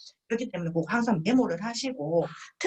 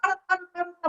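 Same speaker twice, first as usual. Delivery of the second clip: much higher, repeated okay-okay; dismissive brush-off; 10 semitones higher, much faster than usual. Retold or imitated. Guest backing off from the pushy friend, dismissive. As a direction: wave it away impatiently.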